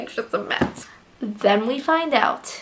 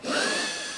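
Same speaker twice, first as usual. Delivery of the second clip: sharp gasp; 10 dB quieter than usual. Retold or imitated. Reacting to bombing in book.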